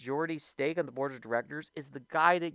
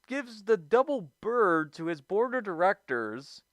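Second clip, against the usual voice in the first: ordinary talk, nothing marked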